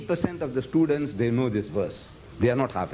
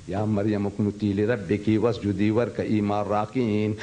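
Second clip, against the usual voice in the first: quick chanted Arabic line; Quran recitation; 5 semitones lower, much faster than usual. Shown as a demonstration. Teacher reciting the verse between translations, in chanted Arabic. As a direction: recite it briskly. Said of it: "You read".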